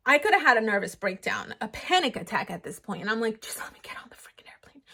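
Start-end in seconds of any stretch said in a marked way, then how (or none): 3.4-4.8 quick, pleading whisper under the breath; desperate whisper. Retold or imitated. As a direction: beg in a hurried whisper. Nervous thought at security line.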